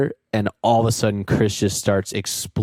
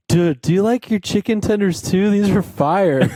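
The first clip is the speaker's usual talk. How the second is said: higher, giggly stoned voice; imitating a stoned friend, higher and laughing; stoned Andy; 9 semitones higher, 3 dB louder than usual. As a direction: play the blissfully high friend, laughing through it. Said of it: "He's like".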